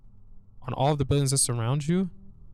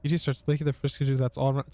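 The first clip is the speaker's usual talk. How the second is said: normal talking voice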